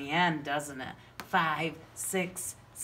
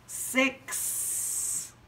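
gasping surprise; 7 semitones higher than usual. Acted. Gasp of discovery.

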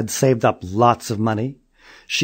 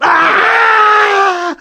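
very high loud shriek; horrified scream; 11 dB louder than usual. Acted. Mother screaming at Julie's face, very high loud shriek. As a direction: scream in shock. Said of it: "looked up and yelled".

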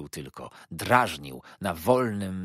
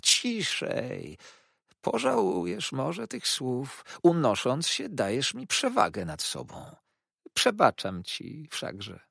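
much higher, slow, syrupy condescension; oily humble clerk; 8 semitones higher, slower than usual. A narrator's reading. Uriah hushes Copperfield and forgives him, high, slow, syrupy. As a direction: forgive him with poisonous sweetness.

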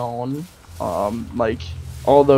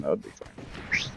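whispered; 11 semitones lower, 14 dB quieter than usual. Real, not acted. Aside while playing.

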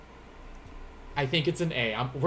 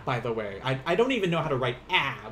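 normal talking voice

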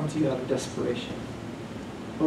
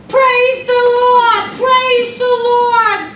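put-on very high, loud, slow screeching old lady; shrill sung-out old woman praising; screeched high-pitched praise; 11 dB louder, much slower than usual. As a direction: screech it out like an elderly church lady. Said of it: "screeched, high-pitched voice".

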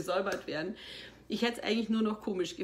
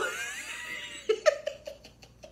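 a bright, high burst of laughter at her own story; real laugh. Real, not acted. She laughs while telling the talk show about the fake nose.

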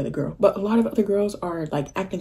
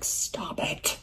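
breathless, hushed whisper; a stunned little whisper; whispered stop it; 10 dB quieter than usual. Retold or imitated. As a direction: barely voice it, star-struck. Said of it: "obviously I didn't say it like that".

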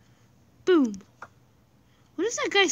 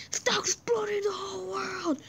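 crying shout; 7 semitones higher, 5 dB quieter than usual. Real, not acted. Creepers blowing up her world.

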